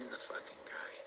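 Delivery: hushed, breathy confession; whisper. Real, not acted. Whispering to viewers that she wants no harm to come to him. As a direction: whisper it like a secret. Confiding about her ex.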